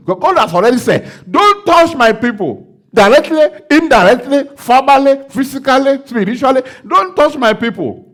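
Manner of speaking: loud, high declaring prayer over the congregation; full-force preaching. Acted. Pastor declaring victory over the congregation, loud and high. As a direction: proclaim it like a revival preacher.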